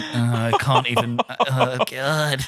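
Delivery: slow, low-energy cringe; drawn-out retold dismay; mortified groan. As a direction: groan it out slowly in mock despair. Retold cringing at overheard insult.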